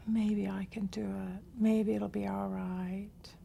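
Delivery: hesitant, half-hearted maybe, whispered slow; tentative. Shown as a demonstration. Teacher demos a tentative statement to avoid. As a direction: sound unsure you can do it. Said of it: "no tentative, no".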